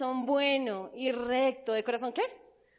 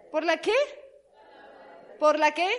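¿Por la qué? louder, rising on qué; loud call; 3 semitones higher, 6 dB louder than usual. Shown as a demonstration. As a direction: push the crowd for the answer. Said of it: "call-and-response to congregation".

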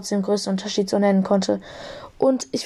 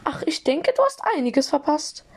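high-pitched, amused little voice; 6 semitones higher than usual. A narrator's reading. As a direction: tease lightly in a squeaky voice. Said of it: "entgegnete er mir belustigt".

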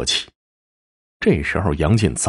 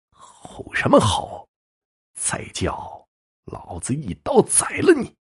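slow growing threat, pitched up at the end; menacing threat; 6 semitones higher, 4 dB quieter, slower than usual. A narrator's reading. Henchman with dagger threatens the bound husband, menacing. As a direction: menace him, drawing out the first words.

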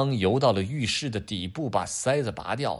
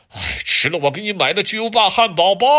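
loud, higher calling out; 9 semitones higher, 9 dB louder than usual. Acted. Dad calling down the drain to Adam, loud call.

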